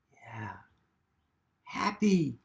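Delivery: ordinary delivery, nothing marked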